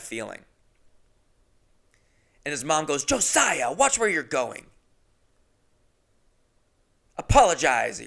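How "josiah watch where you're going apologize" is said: scolding mom. Retold or imitated. Mom scolding her son.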